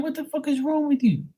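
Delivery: very high-pitched mock disbelief; exasperated outburst. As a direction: squeal it in exaggerated exasperation. Exasperated outburst at dad, retold.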